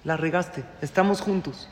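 soft, gentle comfort to the son; calm him after his mistake; reassuring parent. Shown as a demonstration. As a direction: parent softly comforting a son who messed up. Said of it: "recalcular la ruta".

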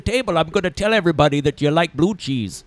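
put-on salty Maine fisherman accent. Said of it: "that was a fisherman from Maine".

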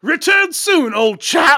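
loud, high shouted gentleman farewell; old English gentleman. Pretend. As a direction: call out like a jolly old Brit. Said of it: "Mystic Manor doesn't have this".

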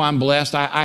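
ordinary delivery

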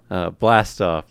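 catchphrase. Shown as a demonstration. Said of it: "That's my catchphrase here".

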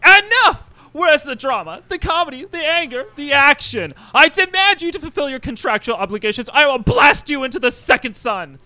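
high, ranting showman; Mojo, tyrannical director, furious. Acted. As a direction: open with a shout, then rant high.

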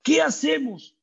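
high, pleading cry of panic; the trapped people crying out in mock panic; panicked cry. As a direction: wail it like a trapped crowd. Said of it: "empezaron a clamar".

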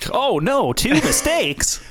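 high-pitched, drawn-out fake shock; mock dismay. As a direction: feign horror, breaking into a laugh. Mock dismay at another Hitler mistake.

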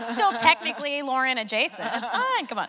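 mock disappointed fan. Mock fan consoling self.

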